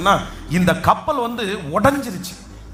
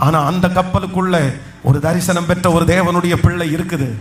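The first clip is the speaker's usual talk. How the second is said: ordinary talk, nothing marked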